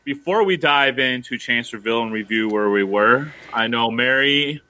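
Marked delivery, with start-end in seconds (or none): none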